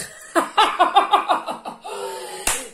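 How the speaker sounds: short embarrassed burst of laughter. Real, not acted. Laughing at herself over a card she doesn't understand. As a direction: laugh it off, a little sheepish.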